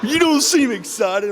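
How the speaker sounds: high, loud teasing imitation of Bissy; mock Bissy voice. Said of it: "she's like".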